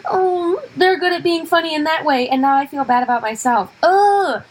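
high envious moan ending in a groan; hammed-up wounded jealousy, pitched high; mock annoying self-pity. Comic mocks fellow comics' envy, self-pitying complaint. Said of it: "We all are so annoying that we're like".

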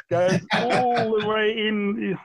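slowly trace the needle going all the way in; drawn out slowly. Shown as a demonstration. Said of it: "exactly how you're showing there".

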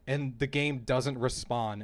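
ordinary talk

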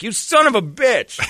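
high, slow mock-outraged cuss; retold indignant cursing. To his laughing cop buddy, retold in high indignation. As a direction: stretch out the curse at a prankster. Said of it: "I'm like".

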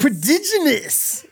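very high and slow; slow drawn-out quote. Retold or imitated. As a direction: stretch it out in mock awe. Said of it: "Remember how the guy's like".

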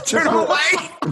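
high, drawn-out shout of disbelief; incredulous outburst. Real, not acted. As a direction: shout it back as an astonished question. Incredulous echo of friend's joke.

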